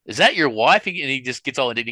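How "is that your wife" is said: higher, louder mock question to the crowd; comedian's question. Comedian asks the brother in the crowd, louder and higher. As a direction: toss it at him like a comic working the room. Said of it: "looks down at him and goes".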